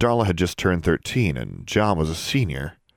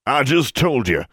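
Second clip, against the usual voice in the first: put-on huffy old wizard; 7 semitones higher, 3 dB louder than usual. Irritated portrait wizard.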